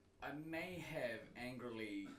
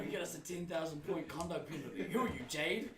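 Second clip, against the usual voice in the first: scolding half-lost in laughter; mock telling-off, louder and higher; retold scolding; 6 semitones higher, 8 dB louder than usual. Uncle telling off an angry player, laughing retold scolding. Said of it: "gave me a talking to".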